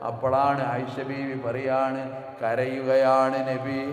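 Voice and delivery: loud, slow sung sermon chant; fervent melodic preaching with recited verses; preaching at full force. Acted. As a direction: pour out the story as a rising chant.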